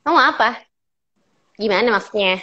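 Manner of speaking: challenging warrior questioning; short questions, as if picking a fight. Shown as a demonstration. Trainer showing a confrontational questioner, warrior tone. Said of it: "Lalu ada tonasi yang warrior".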